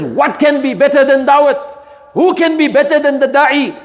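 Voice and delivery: lifted, emphatic challenge to the crowd. Shown as a demonstration. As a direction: throw down the challenge. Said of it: "Quran is challenging".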